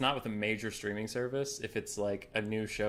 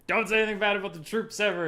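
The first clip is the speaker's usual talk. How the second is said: high, loud and fast, scolding; hammed-up touchy patriot scolding a joker; mock indignant patriot; 10 semitones higher, 5 dB louder, faster than usual. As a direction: snap it like an offended flag-waver. Voicing touchy patriotic people.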